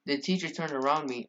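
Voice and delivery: ordinary talk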